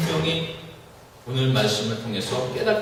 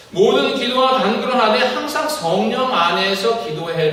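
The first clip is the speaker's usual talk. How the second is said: raised, proclaiming verse reading; solemn preached verse; scripture reading; 6 semitones higher, 4 dB louder than usual. Acted. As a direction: declare the verse to the congregation.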